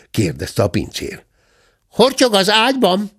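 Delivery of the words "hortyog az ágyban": high-pitched cub voice; bear cub. A narrator's reading. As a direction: answer in a high little voice. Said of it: "felelte Zebike".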